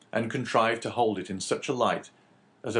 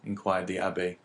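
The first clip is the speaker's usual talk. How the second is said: plain reading voice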